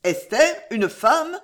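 astonished cry. A narrator's reading. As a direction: cry out, pitch leaping up on each phrase. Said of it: "s'écria l'auteur des Marguerites". (exclamation étonnée)

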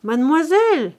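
high, loud summons; calling out. A narrator's reading. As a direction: cry out to someone in another room. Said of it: "cria la cuisinière".

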